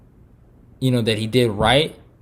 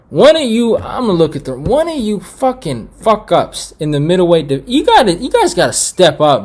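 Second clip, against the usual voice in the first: higher, louder call-out to the division; shouted challenge; 7 semitones higher, 4 dB louder than usual. Real, not acted. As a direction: yell at the whole division to step up. Calls out middleweight division.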